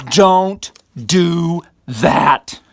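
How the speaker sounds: stern shout. Real, not acted. Lecturer warns students against dredging up old scandals, loud stern shout. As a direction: bark it out so it sticks. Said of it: "Did I just frighten you?".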